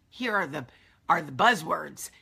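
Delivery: ordinary talk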